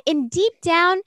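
usual speaking voice

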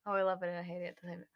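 very low, rushed deep line; extremely deep bass voice. Shown as a demonstration. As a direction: go as deep as you can. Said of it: "I can't just say that without singing it".

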